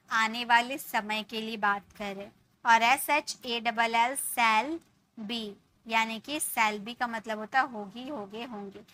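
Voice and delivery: ordinary talk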